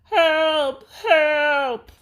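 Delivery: very high, loud panicked cry; a scared boy shrieking for help; screaming for help. A narrator's reading. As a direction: yell it like a terrified kid.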